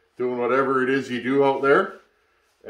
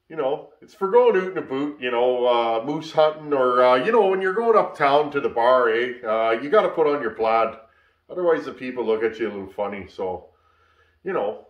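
put-on Canadian accent. Vlogger joking to viewers about wearing plaid uptown, playful Canadian accent. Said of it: "That's my best Canadian accent".

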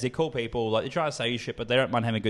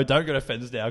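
ordinary delivery

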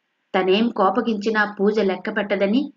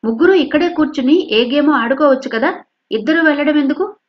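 higher, louder excuse-making; apologetic explaining; 6 semitones higher, 5 dB louder than usual. Acted. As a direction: justify herself to guest. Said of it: "సంజాయిషి ఇస్తున్నట్టుగా".

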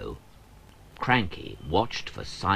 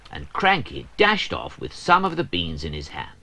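usual speaking voice

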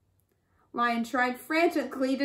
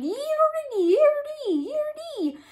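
running sound; slower than usual. A narrator's reading.

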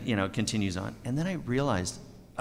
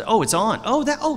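high, loud flash of recognition; startled recognition; 11 semitones higher, 7 dB louder than usual. Retold or imitated. Actor reliving his commercial coming on, startled. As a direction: pipe up as it suddenly catches you.

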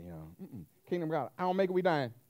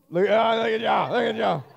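loud mocking call; boastful loud taunt; taunting shout; 4 semitones higher, 12 dB louder than usual. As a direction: gloat over the field loudly. Acted race taunt.

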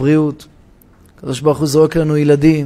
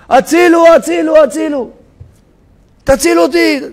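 desperate shout; 10 semitones higher than usual. Retold or imitated. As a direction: yell for rescue, nobody hears. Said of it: "והוא צועק". (צעקת הצילו נואשת וחזקה)

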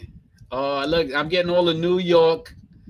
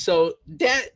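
ordinary talk

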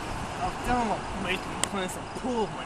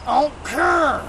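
louder, dismissive retort; defiant reply; 8 semitones higher, 12 dB louder, slower than usual. Retold or imitated. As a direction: fling it back carelessly. His own retold reply.

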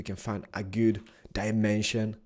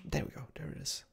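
ordinary delivery

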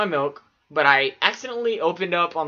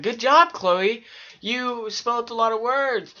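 mock praise; 6 semitones higher than usual. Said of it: "I was like".

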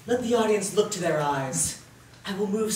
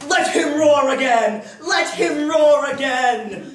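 loud lion roar, raised high; 6 semitones higher, 6 dB louder, much slower than usual. Acted. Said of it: "I will roar".

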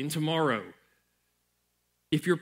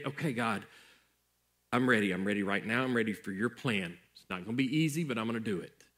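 a low, softer voice of quiet surrender; hushed prayer; 6 semitones lower, 3 dB quieter than usual. Acted. Pastor voices the congregation's quiet prayer of surrender. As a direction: pray it under your breath to God.

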